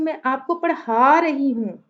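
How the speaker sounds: पढ़ा stretched and made prominent; stress on पढ़ा. Shown as a demonstration. Teacher shows the meaning changing, stress on पढ़ा. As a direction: lean hard on the second syllable of पढ़ा. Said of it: "मैंने पढ़ा पे stress कर दिया".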